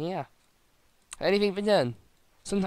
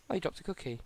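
ordinary talk

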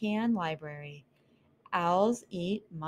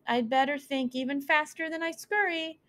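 higher, hurried inner voice; anxious mouse; 8 semitones higher than usual. Acted. Mouse Cleopatra thinking fast near the owl, higher voice. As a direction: plot quickly under your breath.

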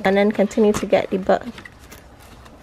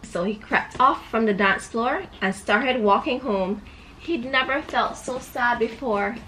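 put-on storybook voice for a little girl; reading to a child; 4 semitones higher than usual. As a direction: read it like a bedtime book. Reads picture book to child.

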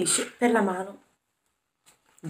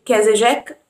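ordinary delivery, nothing marked

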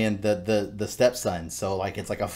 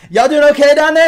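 very high, loud, sing-song wife voice; wives calling down; 16 dB louder than usual. Acted. Wife checking on the men in the basement, high and loud. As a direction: call sweetly down the stairs. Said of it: "you hear the wives going".

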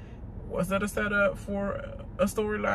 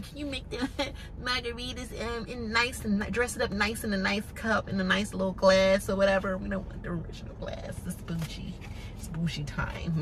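hushed bougie order; 4 dB quieter than usual. Retold or imitated. Marlo voiced ordering drinks.

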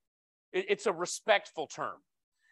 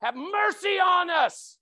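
high, loud, drawn-out plea for mercy; loud cry from afar; 11 semitones higher, 8 dB louder, much slower than usual. Retold or imitated. Lepers calling to Jesus from far off, loud plea. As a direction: call out across the distance, begging. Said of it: "it says in a loud voice from far off".

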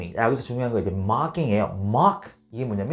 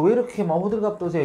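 high, quick mock brush-off; imitated scoffing question, pitched up and hurried; dismissive retort; 9 semitones higher, faster than usual. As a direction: act out belittling someone's complaint. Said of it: "이런 식으로 막 대하면은".

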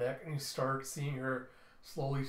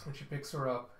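usual speaking voice

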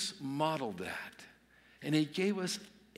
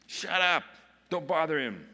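loud, raised-pitch scolding; stern hushing; 4 semitones higher, 6 dB louder than usual. Acted. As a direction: cut someone off sternly and loudly. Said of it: "they were sternly telling him".

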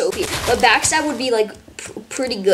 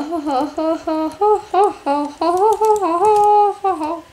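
mock taunting laugh; 7 semitones higher, much slower than usual.

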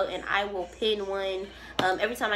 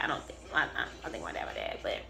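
mutter; 8 semitones lower, 6 dB quieter, faster than usual. Real, not acted. Hesitant muttering about dad.

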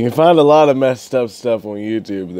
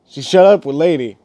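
ordinary talk, nothing marked

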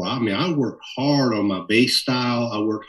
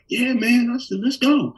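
much higher, bright eager yes; 14 semitones higher than usual. Retold or imitated. Young bassist agreeing to fly to LA, high eager voice. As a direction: jump at the chance.

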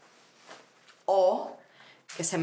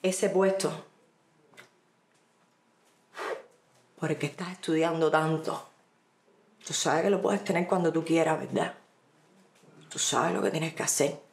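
lightly voiced boss, with long pauses; insinuating boss; 2 semitones lower than usual. Woman re-enacts boss cornering her alone, lightly voiced insinuation. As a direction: spell out the indecent offer in measured, insinuating pieces. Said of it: "y me dijo".

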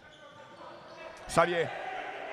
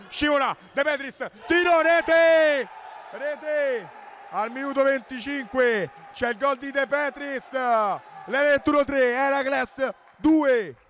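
breathless italian goal commentary; 6 semitones higher, slower than usual. Real, not acted. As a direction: scream the goal and stretch the score. Goal called, score stretched out.

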